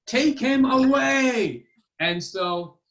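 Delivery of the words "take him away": loud, high, slow royal command; slow shouted order. Acted. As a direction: drag out the order to the guards. King orders the storyteller removed.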